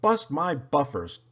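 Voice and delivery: ordinary delivery, nothing marked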